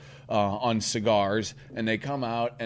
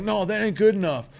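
higher mock bully voice; raised, aggressive retelling of a taunt; imitated belligerence; 10 semitones higher, 4 dB louder than usual. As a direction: act out a bully refusing to back off. Acted bully in a quarrel.

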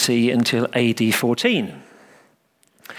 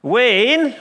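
put-on much higher, louder insistent child; boy crying out undeterred; 11 semitones higher, 4 dB louder than usual. A narrator's reading. Little boy insisting to the vicar, undeterred. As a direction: insist like a stubborn little lad. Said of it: "repeated the child".

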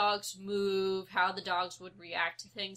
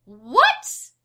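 retold yell; 2 semitones higher, 16 dB louder than usual. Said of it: "I was yelling".

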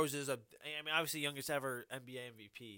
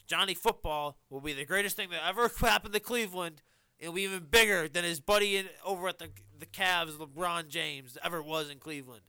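hyped-up pundit; 3 semitones higher, 7 dB louder than usual. Retold or imitated. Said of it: "once again, saying".

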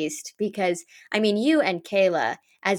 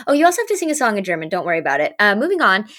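rushed mock-casual; much faster than usual. Retold rushed casting reveal.

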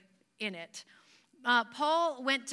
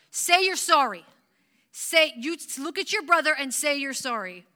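loud, raised parent voice ordering an apology; scolding parent; 4 semitones higher, 9 dB louder than usual. Retold or imitated. Parent to child who hit his brother, loud scolding. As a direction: bark it like a fed-up parent.

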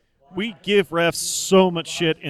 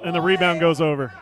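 ordinary delivery

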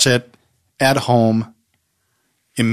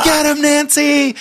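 high, stretched-out cheering yell; excited cheer; 4 dB louder, slower than usual. Retold or imitated. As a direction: shout encouragement at the screen. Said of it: "you'd hear her scream".

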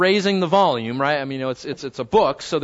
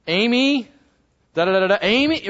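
raised-pitch repeated name, lightly acted; mock parental calling; 3 semitones higher, 3 dB louder than usual. Parent repeating a name to get through to a child, mock insistent calling. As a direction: call the name twice to get a child's attention. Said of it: "we might repeat ourselves. We might say".

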